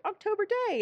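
high, gleeful realisation; light up at spotting a friend's voice; delighted recognition. Retold or imitated. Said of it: "and I was like".

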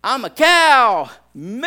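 loud, slow drawn-out moo; dad mooing at red light, loud and slow; mooing cow. Retold or imitated. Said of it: "and I go".

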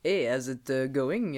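low, hesitant hello; third voice. Shown as a demonstration. Confessing a third voice, low hesitant greeting. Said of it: "I've got a third".

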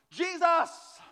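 high, loud exasperated cry; exasperated Martha. Retold or imitated. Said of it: "and then she goes".

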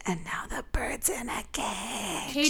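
hushed unvoiced reveal; whisper. Real, not acted. Cohost pointing out the cage metaphor, whispered. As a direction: whisper it like a secret.

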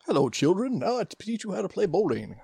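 high, hammed-up old instructor; mock foreign coach voice. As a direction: play a silly bowling teacher. Mock teacher voice for flub.

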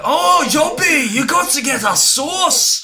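very high, loud, drawn-out shout; hammed-up high Scottish holler; Billy Connolly impression. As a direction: yell the pitch in a piercing comic voice. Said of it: "Billy Connolly making a pitch".

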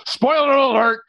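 mock alarm. Mock warning about movie spoiler.

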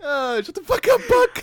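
high, loud mock yell; laughing shout. Mock yelling at friend.

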